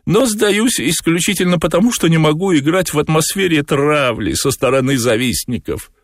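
very high, squeaky cat voice; sustained high-pitched cat voice; cat character. A narrator's reading. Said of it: "сказал кот".

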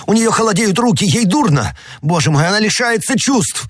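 very high, alarmed cries; panicked shouting. A narrator's reading. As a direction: shout in panic over someone fainting. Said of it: "закричал Д'Артаньян". (панический крик на высоких нотах)

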